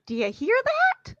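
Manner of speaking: high, slow quavery question; stretched question rising at the end; imitated aunt. Quoting Aunt Bethany.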